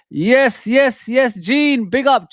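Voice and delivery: loud, high, buzzing greeting; excited shout-out. Real, not acted. Streamer hyping viewer Gene, excited loud shout-out. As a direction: hype up a viewer by name.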